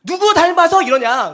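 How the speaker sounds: loud, high mock outburst; comic paternal scolding, loud and high; proud scolding father. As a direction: bellow like an exasperated dad. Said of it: "자존감이 높은 아저씨들 이렇게 하죠".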